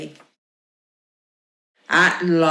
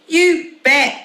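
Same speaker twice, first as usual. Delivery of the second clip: high, loud eager yell; 9 semitones higher, 5 dB louder than usual. Acted. Boy yells his promise to help dad. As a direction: shout it out keenly. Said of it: "yelled Lester".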